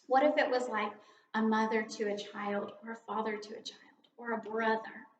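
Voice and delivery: ordinary talk, nothing marked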